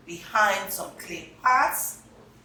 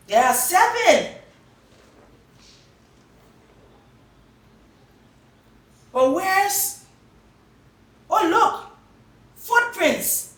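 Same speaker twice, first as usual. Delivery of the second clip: high, loud and eager; high, loud excited child; excited discovery; 13 semitones higher, 9 dB louder than usual. Acted. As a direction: cry out at the find.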